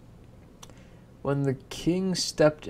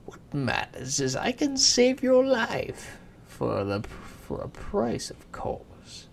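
much higher, sly bargaining imp; giggly sneering imp; 9 semitones higher, 5 dB louder, slower than usual. Acted. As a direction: tempt her, slowing on the price.

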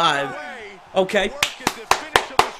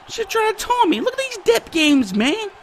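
squeaky-high incredulous complaint; high-pitched indignation; 12 semitones higher than usual. Real, not acted. Gamer about taunting opponent, high indignant whine.

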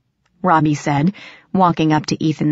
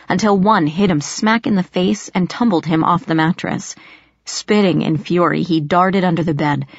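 ordinary delivery

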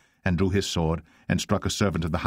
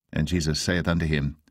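ordinary delivery

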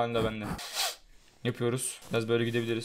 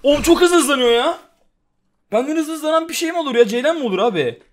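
amazed outcry; 10 semitones higher, 7 dB louder than usual. Real, not acted. Gamer amazed by fast animal.

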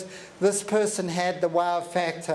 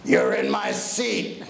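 much lower, slow and heavy giant's voice; big man's voice; slower than usual. Retold or imitated. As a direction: loom over him with a deep, slow rumble. Huge man looming over seat.

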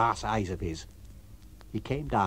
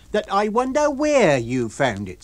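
high, louder, slow repeat; Mrs Hall, pointed; 8 semitones higher, 6 dB louder, slower than usual. Acted. Landlady repeating her suspicion at the lodger. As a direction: hammer the insinuation home.

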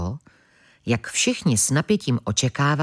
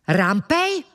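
higher, startled question; surprised exclamation; 7 semitones higher than usual. A narrator's reading. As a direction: exclaim it in surprise, pitch jumping up. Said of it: "vykřikl překvapeně Mlask". (překvapené zvolání)

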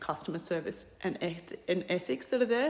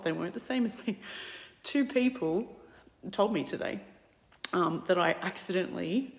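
normal talking voice

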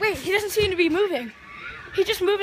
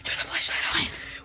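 hushed play whisper; 3 semitones lower, 6 dB quieter, faster than usual. Pretend. Hiding from the skeleton, telling friend in whisper. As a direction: whisper so he can't hear.

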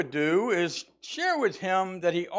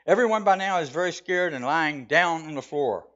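normal talking voice

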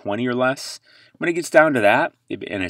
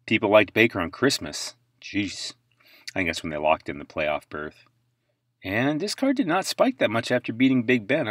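usual speaking voice

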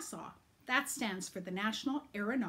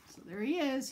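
usual speaking voice

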